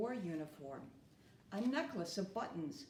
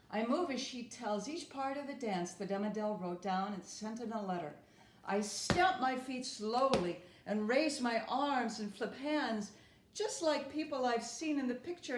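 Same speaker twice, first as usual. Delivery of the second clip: usual speaking voice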